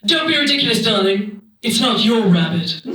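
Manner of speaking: put-on dismissive old-timey accent, dropping in pitch; Charlotte. Hostess dismisses guest's worry about rabbits, old-timey disdain. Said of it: "And she said".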